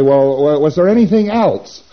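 loud question climbing high; prompting auditor. Acted. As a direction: project the question, pitched well up. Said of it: "And you say".

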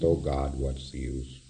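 low, soft acted futility; hopeless resignation. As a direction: act like giving up, voice sinking. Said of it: "That's better than dying".

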